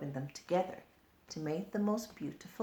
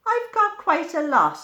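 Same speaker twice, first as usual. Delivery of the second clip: excited boast; 12 semitones higher, 13 dB louder than usual. Acted. Ladybird boasting.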